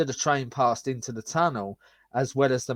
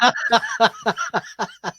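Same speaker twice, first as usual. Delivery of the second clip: high laugh; 10 semitones higher, 5 dB louder than usual. Real, not acted. Laughs after the voice.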